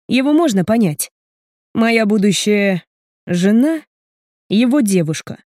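plain reading voice

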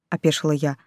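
ordinary delivery, nothing marked

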